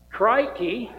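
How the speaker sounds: a cartoon Aussie "Crikey" in a cheesy Australian accent. Shown as a demonstration. Pastor plugging the Australian VBS theme, cheesy Australian accent. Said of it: "talk with a cheesy Australian accent".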